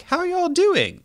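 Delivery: a high, hammed-up Southern howdy; Southern drawl greeting. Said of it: "American Southern way of like".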